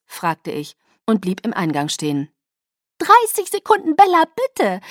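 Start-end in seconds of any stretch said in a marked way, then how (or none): 3.0-4.8 very high, loud, bell-like plea; high-pitched pixie voice, pleading; chirpy Alice figure. Acted. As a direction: trill it out sweetly to the next room. Alice trills from next room.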